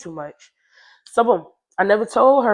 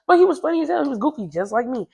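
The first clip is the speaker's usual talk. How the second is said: normal talking voice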